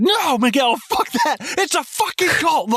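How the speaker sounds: very high, strained mock shout; acted outburst. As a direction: blow up at a friend in disbelief. Acts out outburst; "Through the roof.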